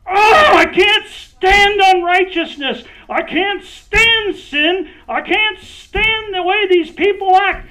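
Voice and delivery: high, loud, slow furious shout; bad God's outburst. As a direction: bellow it like an outraged judge who can't stand sin. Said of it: "he hears the accuser and he goes".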